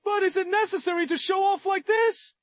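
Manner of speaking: high exasperated whine; overacted anime dub. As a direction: complain with comic indignation. Exasperated complaint at the showing-off.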